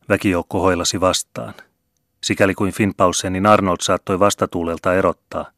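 ordinary delivery, nothing marked

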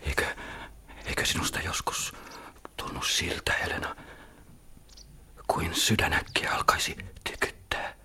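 breathless intimate whisper. Acted. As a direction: let the words come hushed and secret. Lovers whispering together. (kiihkeä kuiskaus)

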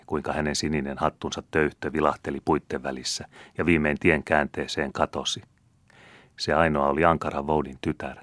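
usual speaking voice

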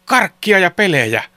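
high, loud storybook cheer; children shouting. A narrator's reading. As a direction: shout it out like eager kids. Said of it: "he huusivat". (lasten kova, korkea huuto)

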